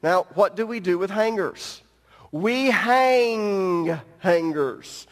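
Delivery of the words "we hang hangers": very slow, word by word; slow emphasis. Acted. Preacher hanging towel on pulpit, drawn out very slowly. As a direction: draw out each word to land the pun.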